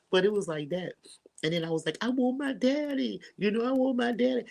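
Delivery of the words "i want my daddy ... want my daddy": put-on little girl crying. Grieving daughter crying to her mom for her late father, high childlike plea. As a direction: beg for daddy like a small grieving child. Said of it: "I felt like a little girl".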